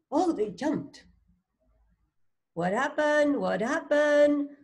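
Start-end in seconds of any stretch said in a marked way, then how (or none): none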